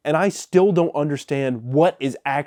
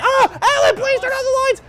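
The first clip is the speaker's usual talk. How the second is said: hammed-up frightened wife, much higher pitch, louder; scared wife; 5 dB louder than usual. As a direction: squeal it like a panicked wife in the dark. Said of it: "she's like".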